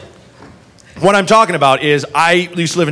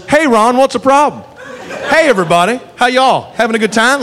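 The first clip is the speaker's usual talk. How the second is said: pitched-up, folksy greeting; easygoing Andy Griffith type, higher voice; genial Southern cop; 7 semitones higher than usual. Retold or imitated. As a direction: greet the whole bar like a jaunty small-town lawman.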